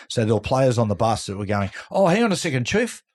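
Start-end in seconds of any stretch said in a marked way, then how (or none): 1.9-3.0 high, hammed-up fake excuse; high mock panic. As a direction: squeak out a lame excuse to get off. Players faking excuses to leave bus.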